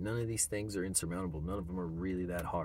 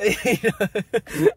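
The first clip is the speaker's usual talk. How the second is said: short loud laugh; 11 semitones higher, 12 dB louder than usual. Real, not acted. Laugh after skit.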